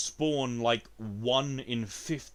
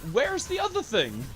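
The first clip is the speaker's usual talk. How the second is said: much higher, loud fed-up yell; angry shout; 5 dB louder than usual. Real, not acted. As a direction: demand it furiously. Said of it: "because I'm so mad".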